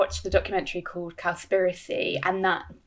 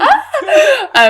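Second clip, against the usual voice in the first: much higher, loud retold scream, laughing; mock scream; 13 dB louder than usual. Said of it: "So literally I was like".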